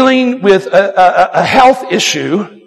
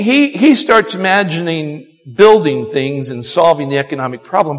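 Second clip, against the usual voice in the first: ordinary delivery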